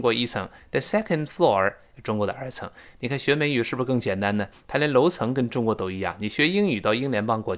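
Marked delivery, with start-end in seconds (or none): none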